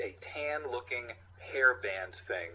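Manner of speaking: ordinary talk, nothing marked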